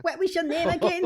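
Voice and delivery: put-on high elderly lady voice asking a question; old pensioner voice. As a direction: ask his name like a sweet old granny. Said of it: "pensioners on the phone going".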